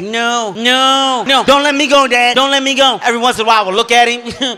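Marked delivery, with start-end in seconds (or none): 0.0-3.0 high, acted panicked begging; traumatized flashback pleading. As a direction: plead as if reliving the drowning. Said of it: "you become traumatized".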